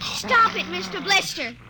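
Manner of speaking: ordinary talk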